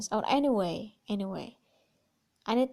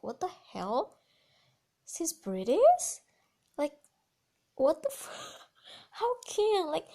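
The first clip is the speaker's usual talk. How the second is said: retold shock; 7 semitones higher than usual. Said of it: "And I was like".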